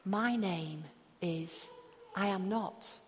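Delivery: slow, soft murmur of "My name is I am not"; hushed self-affirmation; quietly to yourself. Shown as a demonstration. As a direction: say it softly, as if to yourself. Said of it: "even quietly whisper it".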